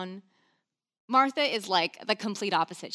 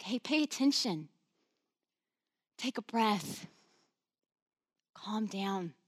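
calming; 3 dB quieter, slower than usual. Retold or imitated. Jesus calming Martha.

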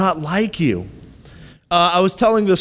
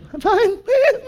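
much higher, strained reply with a laugh; nervous squeak; 14 semitones higher than usual. Retold or imitated. Awkward pastor answering the friendly barista, nervous squeak. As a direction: blurt it out like a scared little mouse. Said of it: "I was, like, so scared".

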